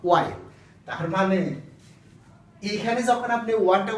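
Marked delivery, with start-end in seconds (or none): 0.1-0.4 ওয়াইল with a clear w glide at the start, lower; oil said with an opening w sound; correct pronunciation. Shown as a demonstration. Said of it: "মূল উচ্চারণটা হলো".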